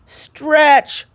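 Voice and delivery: high, loud yelled order; yelling. Acted. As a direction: bark the word stretch at him. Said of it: "yelled the bug".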